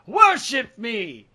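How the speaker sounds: booming villain demanding worship, high and loud; grand slow cry of a megalomaniac cartoon villain; Jonathan Crane. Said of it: "I feel like that Jonathan Crane".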